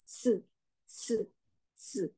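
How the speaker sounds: "S" as a soft "seu", no t at the start; plain hissed s. Shown as a demonstration. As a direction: say seu softly with a buzzed vowel. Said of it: "Kemudian kalau S". (bunyi "seu" pelan, bukan "si")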